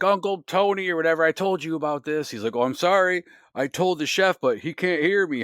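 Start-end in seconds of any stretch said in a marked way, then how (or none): 0.0-2.3 high, loud mock complaint to his uncle; imitating Freddy. Freddy scolding Uncle Tony, high and loud mock voice. 2.6-5.4 high, loud mock apology with an odd voice; imitating the uncle. Uncle apologising to Freddy about the deaf chef, high mock voice.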